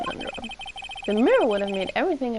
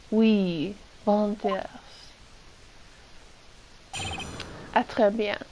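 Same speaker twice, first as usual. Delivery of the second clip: put-on terrible French accent. Streamer voicing the French chef to the defense attorney, bad French accent. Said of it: "terrible French accent".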